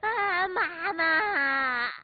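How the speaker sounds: slow, loud wailing through tears; a hammed-up crying kid; sobbing child. Stung child sobbing to his mother, slow and loud. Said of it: "泣不成声地说".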